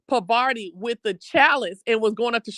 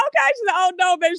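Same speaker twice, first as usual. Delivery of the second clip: high, excited squeal of a quote; giddy high replay of her line; excited retelling; 9 semitones higher, 3 dB louder, faster than usual. Real, not acted. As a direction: gush it up high, thrilled.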